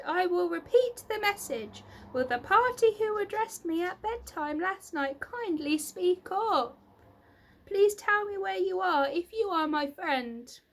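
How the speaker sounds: high, loud pleading announcement; loud call. A narrator's reading. Wilbur repeats his call.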